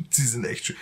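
laughing ironically. Real, not acted. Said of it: "Feuermelder sind potthässlich".

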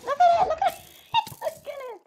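very high, loud squeal breaking into laughs; squealing laughter. Real, not acted. Gamer mocking a stupid mob, squealing with laughter. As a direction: squeal the words while cracking up.

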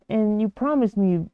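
ordinary talk, nothing marked

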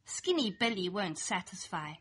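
plain reading voice

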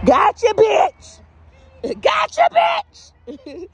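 high, drawn-out gotcha yell; playful shout. Pretend. Vlogger teasing people in the gas line, playful shout. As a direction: holler it long and high, in play.

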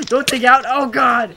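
loud, high outburst; alarmed shout. Real, not acted. Player in game mishap, alarmed shout. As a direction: cry out in sudden alarm.

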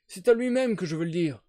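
put-on old man refusing to deal with the stand-in; quarrelsome old man. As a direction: dig in like a cranky old sailor. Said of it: "répéta-t-il avec l'obstination querelleuse d'un très vieil homme".

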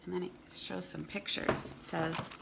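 clear, steady read-aloud voice; storybook reading for kids; reading to children. Acted.

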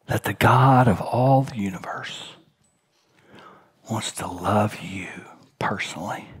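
hushed whisper, drawn in close; a whispered wonder at God's love; whispered awe. Real, not acted. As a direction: lean in and whisper it. Preacher stresses God's love.

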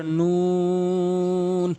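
"নুন" with rounded lips, long held; drawn-out letter. Shown as a demonstration. Teacher demonstrating, long rounded "নুন". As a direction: stretch the "oo" of "নুন" on one note. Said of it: "একটু টান দিয়ে উচ্চারণ করবেন".

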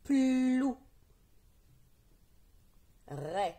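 each syllable isolated, first consonants held long; teacher modelling syllables for repetition, drawn out and firm; syllable reading drill. Shown as a demonstration. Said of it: "C'est parti pour la lecture des syllabes". (lecture de syllabes isolées, consonnes étirées, bien appuyées)

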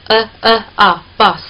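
呃呃啊 then bus with the open vowel, slowly; slow drill leading into bus; vowel demo. Shown as a demonstration. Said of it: "是这样发音的".

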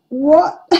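high, loud startled what; shocked what. Retold or imitated. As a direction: blurt it out in disbelief. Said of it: "And Aaron's like".

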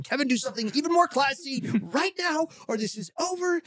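high-pitched, panicked self-command; frantic inner voice. Retold or imitated. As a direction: order yourself in a hammed-up panic. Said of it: "but my brain does it again".

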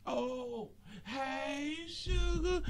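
soft, high singsong hello; high and stretched, gently sugary; sweet greeting. Real, not acted. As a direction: coo a drawn-out greeting to a favorite. Sweetly greeting another viewer.

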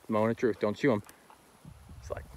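usual speaking voice

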